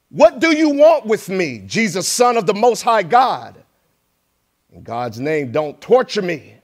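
high, loud desperate cry; shouting. Acted. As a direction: cry out like a tormented man. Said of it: "he shouted at the top of his voice".

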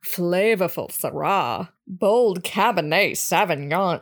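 slow, drawn-out label reading in character; slow put-on character voice, softening at the end; Karen Brewer. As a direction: read the label as a put-on character, lingering on each phrase. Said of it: "it turned into Karen Brewer".

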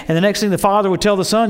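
usual speaking voice

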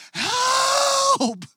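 very high, long desperate scream; screamed cry for help. Retold or imitated. Alone on his Jeep at night crying to God, desperate high scream. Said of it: "screaming in the middle of the night".

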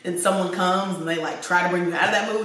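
ordinary talk

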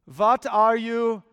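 puzzled German radio operator, accent badly faked, "thinking" said as "sinking"; terrible German accent. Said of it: "Terrible accent".

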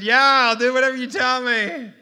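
high, loud, all-in yes; eager agreement. Retold or imitated. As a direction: blurt out total commitment. Retold eager agreement to therapy.

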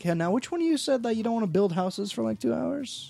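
high, teasing mock of a friend. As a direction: needle him in a high taunt. Mocking a friend's earlier complaint.

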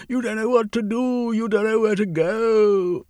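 high, loud pleading call; yelling after them. A narrator's reading. Diamone yells after fleeing workers, high and loud. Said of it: "Diamone yelled".

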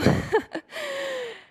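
high laugh, trailing down. Real, not acted. Preacher joking about broken tear ducts, high laughter.

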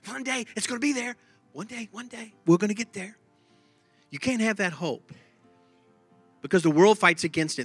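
ordinary talk

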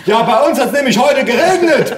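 very high, loud oblivious yell; bellowing grandpa. A narrator's reading. Deaf grandpa into grandson's ear, bellowing. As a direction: holler it right into someone's ear. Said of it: "brüllt mein Opa ins Ohr".

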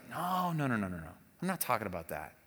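gentle "no, no" of a teacher; calm correction. Retold or imitated. James correcting the complaining believer, low and calm. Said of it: "And James is like".

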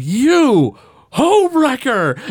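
cartoon dad hollering homewrecker; mock furious Homer. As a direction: bellow it at the kid like a jealous dad. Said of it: "he's like".

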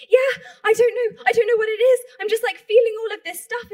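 high, loud overwhelmed reply, imitated; lightly imitated tearful gush; retold tearful outburst. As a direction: act out a friend overcome by feeling. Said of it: "She was in tears".

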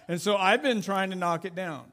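ordinary talk, nothing marked

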